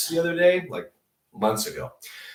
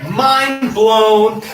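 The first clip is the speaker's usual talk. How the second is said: higher, louder "mind blown"; excited; 9 semitones higher, 11 dB louder than usual. Real, not acted. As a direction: shout it in amazement. Excited exclamation.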